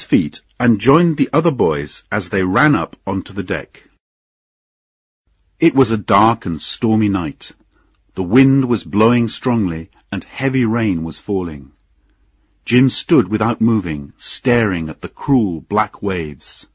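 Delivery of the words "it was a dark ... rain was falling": usual speaking voice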